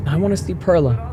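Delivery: put-on pleading girl. A narrator's reading. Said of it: "I muttered".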